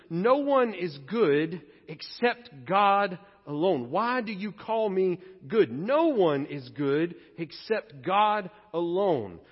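slow, weighty, each word set down; Jesus pressing the rich young ruler; slow firm words. Retold or imitated. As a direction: lay it down slowly and firmly. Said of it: "Listen to how Jesus responds".

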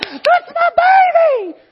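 huge high-pitched drawn-out scream of pride; shrieking, stretched-out cheer; cheering mama. Retold or imitated. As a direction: holler it like a wild proud mama in the stands. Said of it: "that mama goes".

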